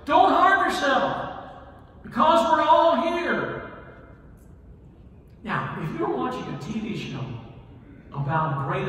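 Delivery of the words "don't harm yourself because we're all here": high, loud and slow, calling across the jail; urgent loud cry of reassurance. Acted. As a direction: shout it out to stop him. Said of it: "called out in a loud voice".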